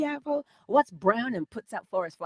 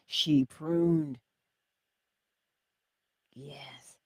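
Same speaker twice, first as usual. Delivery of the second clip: usual speaking voice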